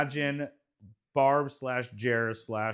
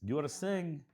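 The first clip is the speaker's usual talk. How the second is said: softer, halting mock Russian accent; 2 semitones higher, 6 dB quieter than usual. Host challenging co-host, mock Russian accent. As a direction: ask haltingly in fake Russian. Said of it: "Russian accent serious".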